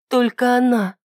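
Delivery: put-on high, trembling girl voice. A narrator's reading. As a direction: answer with a shaking voice. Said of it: "дрожащим голосом ответила Ида".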